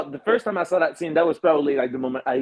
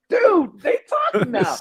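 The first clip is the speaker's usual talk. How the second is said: excited yell; 13 semitones higher than usual. Retold or imitated. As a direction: blurt it out like a thrilled fan. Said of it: "And we're like".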